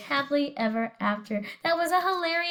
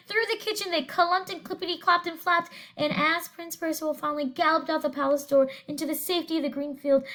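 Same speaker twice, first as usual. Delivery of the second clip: usual speaking voice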